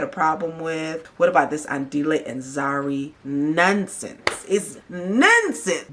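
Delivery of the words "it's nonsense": slow, high drawn-out scoff. Real, not acted. Vlogger dismissing the drama, slow and scoffing. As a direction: stretch it out in disbelief.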